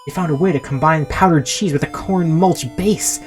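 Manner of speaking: breathless product worship; giddy enthusiasm. A narrator's reading. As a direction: gush like a proud salesman dancing about. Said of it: "while dancing around the room".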